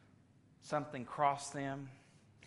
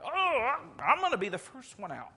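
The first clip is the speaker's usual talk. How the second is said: high, loud eager burst; can't wait to be first; gung-ho Peter; 11 semitones higher, 7 dB louder than usual. Acted. Peter eager to step out onto the water, high and excited.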